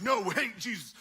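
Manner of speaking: mock anger. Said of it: "they get mad".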